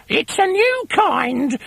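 very high, slow sweet-faked hag; innocent hag wife. A narrator's reading. Wife fibs about the worms, high fake-innocent hag. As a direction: lie it sweetly. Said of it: "Missus Twit said".

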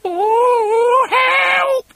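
very high wailing shriek; terrified scream. A narrator's reading. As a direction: scream for help, loud and high. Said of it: "screamed Missus Twit".

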